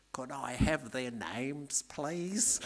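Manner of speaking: slow, deadpan Australian contractor asking politely; Australian accent. Retold or imitated. Contractor to the lifestyler, slow dry Australian accent. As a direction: drawl the punchline in an Aussie accent. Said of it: "this is my best Australian accent".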